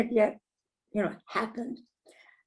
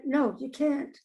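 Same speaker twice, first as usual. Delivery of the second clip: normal talking voice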